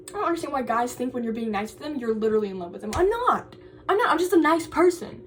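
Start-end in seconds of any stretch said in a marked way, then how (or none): 3.0-5.2 exasperated denial. Real, not acted. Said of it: "denying to an imagined guy".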